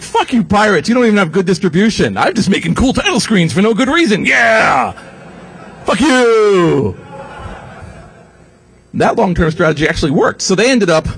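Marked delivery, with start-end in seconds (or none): 0.0-7.0 high-pitched mock rant; defiant title-screen makers. Title-screen makers telling off the pirates, hammed-up defiance. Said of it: "guys making the title screens were like".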